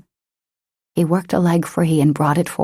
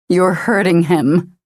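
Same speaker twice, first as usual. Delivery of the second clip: furious; 3 semitones higher, 3 dB louder than usual. A narrator's reading. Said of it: "so much fury in her voice".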